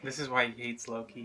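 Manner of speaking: normal talking voice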